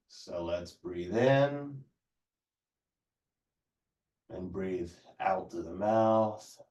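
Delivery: calm, even yoga teacher cues; yoga instruction. Acted. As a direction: guide the class through breaths unhurriedly. Leads a stretching class.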